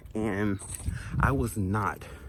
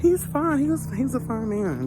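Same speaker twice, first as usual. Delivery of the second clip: excited gushing; 8 dB louder than usual. Real, not acted. Gushing over ex.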